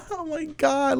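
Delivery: high, breathy put-on office gasp; mock fake-friendly gasp. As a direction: coworker at the water cooler, hammed-up fake surprise. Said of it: "He's like".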